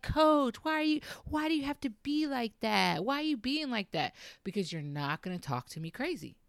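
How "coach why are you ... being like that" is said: much higher, pleading complaint; a whining player protesting to her coach; whiny teen girls. Retold or imitated. Coach imitating her players whining at her. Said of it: "they used to be like".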